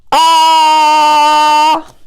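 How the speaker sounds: long, loud wailed "ah"; pretend baby cry. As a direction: hold the short vowel like a crying baby. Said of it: "when this letter starts crying".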